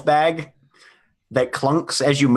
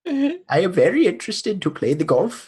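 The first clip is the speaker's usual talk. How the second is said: put-on Swedish accent. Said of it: "you sound way more like you're trying to do an offensive Indian accent".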